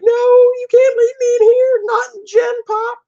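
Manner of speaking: high, loud crying plea; desperate plea. Acted. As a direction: beg them not to leave you, wailing. Prisoner dragged away pleading.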